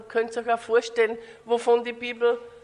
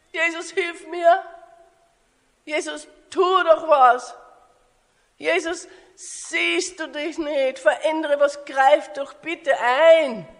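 higher, pleading cries to Jesus; crying out; 4 semitones higher, 3 dB louder than usual. Acted. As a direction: beg urgently, stretching the last plea. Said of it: "Vielleicht schreist du auch so". (flehend zu Jesus rufen)

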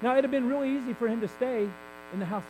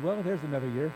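God's dry reply; 6 semitones lower than usual. Acted. God answering the impatient preacher, low and deadpan. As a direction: drop it low and dry for the punchline.

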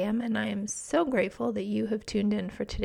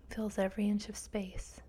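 guided meditation; 4 dB quieter than usual. Acted. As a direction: guide them gently into calm.

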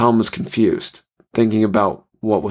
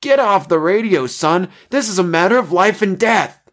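high, urgent angry order; angry shout; 10 semitones higher than usual. A narrator's reading. Pilot ordering an intruder off the radio, angry shouting. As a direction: order him off, voice pitched right up.

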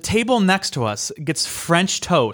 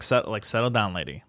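low, dropping aside to a pushy server; retold under-the-breath telling-off; muted rebuke; 5 semitones lower, 6 dB quieter than usual. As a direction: mutter it down low, exasperated. Retold rebuke to hasty waitress.

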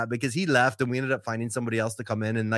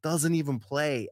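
usual speaking voice

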